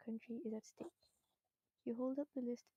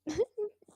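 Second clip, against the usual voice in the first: burst of laughter; 9 semitones higher, 12 dB louder than usual. Real, not acted. Laughing at Scottish attempt.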